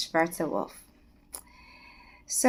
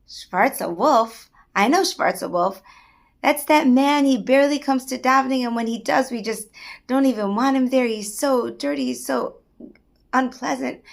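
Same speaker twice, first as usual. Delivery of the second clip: louder, higher dismissive scoff; scornful disbelief; 4 semitones higher, 6 dB louder than usual. Acted. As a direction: sneer at the dirty man. Rabbi scorns the lowly man.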